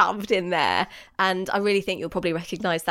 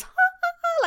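imitated operatic laugh. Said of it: "So whenever she goes".

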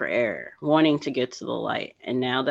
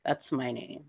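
soft, hushed revelation; awed; 3 semitones lower, 9 dB quieter than usual. Retold or imitated. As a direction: breathe it out in wonder. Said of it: "I just looked up and I'm like".

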